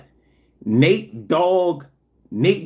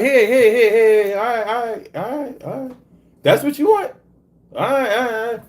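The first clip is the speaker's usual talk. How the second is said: 1 mock placating thieves; 5 semitones higher than usual. Voicing himself placating car thieves.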